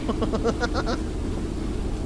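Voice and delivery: soft chuckling run of laughter; quiet, quick staccato giggle. Real, not acted. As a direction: laugh softly at your own slip. Laughs at own foul move.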